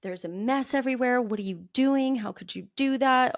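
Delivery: put-on stressed parent scolding child for spilled cereal, exasperated; exasperated parent snapping. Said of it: "and is like".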